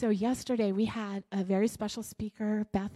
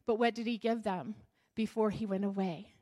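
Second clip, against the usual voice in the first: ordinary talk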